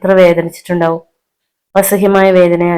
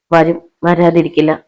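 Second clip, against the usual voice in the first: ordinary delivery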